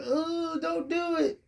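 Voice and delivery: high-pitched mock plea, pleading. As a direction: squeal it like a worried fan. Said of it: "and we're going".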